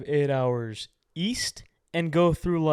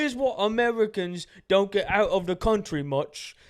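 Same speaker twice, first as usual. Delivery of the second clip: higher, smug British voice; mock British TikToker; 5 semitones higher than usual. Host imitating a British TikToker picking beef in a mock British accent. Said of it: "And they'll be like".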